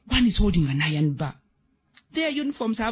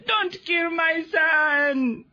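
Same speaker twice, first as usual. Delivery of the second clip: very slow anguished cry, higher; desperate shout; 10 semitones higher, much slower than usual. Acted. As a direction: shout it out, begging for his son. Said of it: "my granddaddy explains".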